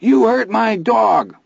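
high, slow shrieking wail. Acted. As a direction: wail it slowly. Milo shrieking.

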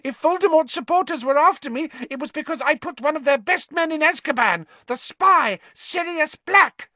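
high, loud, squeaky cry; shrill frightened rat-man squeal. A narrator's reading. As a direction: squeal in terror.

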